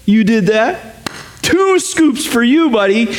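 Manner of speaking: put-on God as parent praising a child, high and delighted. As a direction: reward the kid with glee. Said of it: "He's totally into positive reinforcement".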